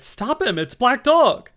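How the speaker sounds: high, loud alarm shout; crying out. A narrator's reading. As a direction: yell it across the tavern. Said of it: "I cried".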